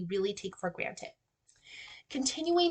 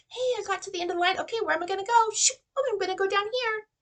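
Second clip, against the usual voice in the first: high, animated read-along voice; high-pitched teacher modeling for young children; thinking aloud for kids; 11 semitones higher, 4 dB louder, much faster than usual. Shown as a demonstration. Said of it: "thinking aloud and modeling".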